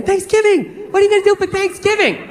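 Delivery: high, gushing girlfriend imitation; mock her eager holiday excitement; excited girlfriend. Girlfriend interrupting the game, imitated high-pitched excitement. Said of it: "so she's all excited".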